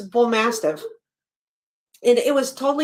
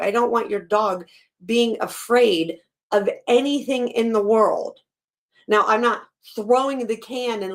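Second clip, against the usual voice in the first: ordinary talk, nothing marked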